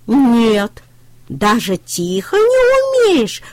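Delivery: puppy can't believe it; astonished puppy. A narrator's reading. Щенок удивляется трактору, очень высоко. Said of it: "удивился щенок".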